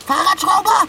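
high, loud shout; indignant high-pitched call; calling after someone. Acted. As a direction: yell after a departing friend. Cat calls after departing friend.

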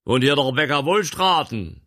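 loud call down from the coach; calling from the wagon. A narrator's reading. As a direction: shout it down to the house, loud and high. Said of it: "fragte eine tiefe Männerstimme vom Wagen herab".

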